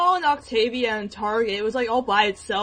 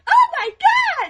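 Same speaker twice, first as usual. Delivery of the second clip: put-on much higher, slow girly cry; 8 dB louder, much slower than usual. Girl imitating Jax in a girly voice. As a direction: squeal it like a girl. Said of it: "like a girly voice".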